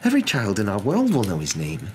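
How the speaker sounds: high, emphatic, exasperated professor; incredulous protest. A narrator's reading. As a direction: argue in disbelief, voice pitched high. McGonagall disbelieving, protesting to Dumbledore.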